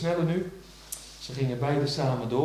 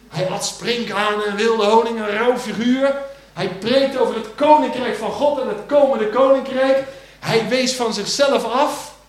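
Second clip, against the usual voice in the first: full-force preaching; 6 semitones higher, 10 dB louder than usual. Acted. Preacher to the church about John the Baptist, booming. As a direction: thunder it over the congregation.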